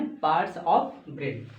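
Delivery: Parts of brain drawn out very slowly, each word separate; teacher repeating the topic very slowly; slow emphatic answer. Shown as a demonstration. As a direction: answer your own question slowly so it sticks. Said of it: "किसके बारे में?".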